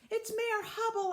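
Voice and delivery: high shout. Acted. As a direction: shout it out, pointing. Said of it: "I shouted".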